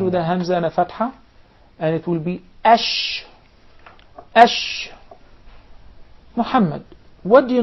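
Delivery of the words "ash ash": ash with a long hushing sh; isolated ash, sheen sustained; sheen with sukun. Shown as a demonstration. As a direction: stop and hold on the sh of ash. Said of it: "the sheen".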